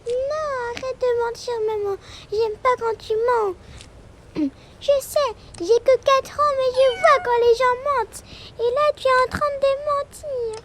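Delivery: put-on high, loud little girl scolding; a tiny child who sees through the lie; four-year-old telling her mother she is lying, high and loud.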